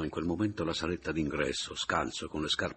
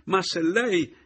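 high, loud protest; outburst; 12 semitones higher, 7 dB louder, slower than usual. A narrator's reading. Adriana contradicting the narrator, outburst. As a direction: burst out, unable to hold back. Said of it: "non poté reggere".

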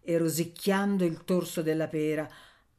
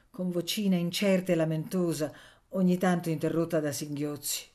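usual speaking voice

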